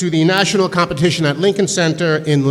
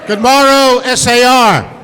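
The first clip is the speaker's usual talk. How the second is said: put-on loud, high, theatrical old-English hail to the hall; mock Shakespearean greeting; 5 semitones higher, 5 dB louder than usual. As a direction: greet them like a stage player from the Globe. Shakespeare teacher greets the school.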